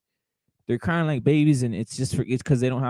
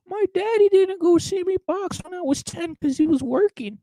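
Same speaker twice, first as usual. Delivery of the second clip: much higher, whiny mock voice of a spoiled boxer; mocking whiny crybaby. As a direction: sneer by whining like a pampered kid.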